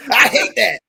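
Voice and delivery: loud, high-pitched comic impression; hammed-up reality-star impression; Joe Exotic. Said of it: "Do your best Joe Exotic accent".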